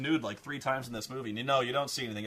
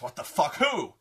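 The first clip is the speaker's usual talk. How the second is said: louder, much higher shocked squawk; outraged queen; 3 semitones higher, 6 dB louder than usual. Retold or imitated. Queen hears she's no longer fairest, loud and shocked. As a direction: blurt it out in disbelief. Queen reacts to mirror's news.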